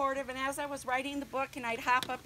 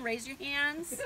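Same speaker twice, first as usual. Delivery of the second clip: ordinary talk, nothing marked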